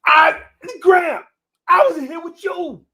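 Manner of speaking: very high, loud pleading; hammed-up panicked wail; wailing kid protest. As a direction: cry out your innocence like a child. Kid protesting innocence to grandma.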